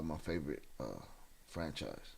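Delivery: ordinary talk, nothing marked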